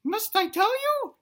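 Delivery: put-on high, squeaky, theatrical child; little dinosaur son. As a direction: squeal it grandly to Mama. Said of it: "he tells his mom, he's like".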